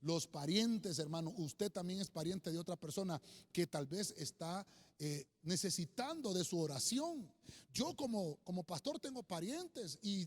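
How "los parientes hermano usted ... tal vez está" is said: normal talking voice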